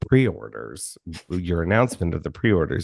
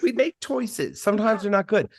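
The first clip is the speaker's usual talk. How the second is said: Goldie Hawn impression. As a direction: float it up high like a starlet.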